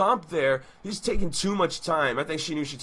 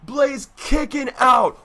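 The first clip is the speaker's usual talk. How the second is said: rising hype, louder toward the kickouts; wrestling commentator; 4 semitones higher, 3 dB louder than usual. Acted. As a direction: call the match like a ringside announcer. Live wrestling play-by-play.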